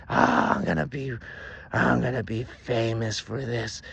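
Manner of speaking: big, drawn-out boast; loud gloating. A narrator's reading. Witch gloats after punching him, loud triumphant boast. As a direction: crow it out in triumph.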